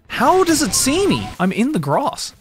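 high incredulous yelp; can't believe it spotted you; panicking gamer. Real, not acted. Spotted while hiding.

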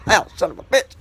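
real pain cry; real pained yelp. Real, not acted. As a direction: yelp at a burnt finger. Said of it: "Just burnt my finger".

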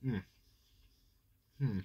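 chewing a bite; talking while chewing food; mouth full, chewing. Real, not acted. Seen 0: Hand at mouth at -7 after "bite"; closed mouth working through labelled words.

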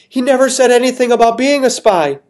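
high, pleading lady voice; begging woman. Acted. Missus Molasses begging the king, high and pleading. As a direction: plead her innocence desperately.

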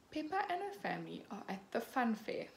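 plain reading voice

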